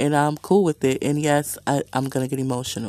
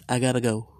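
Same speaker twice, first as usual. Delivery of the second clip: normal talking voice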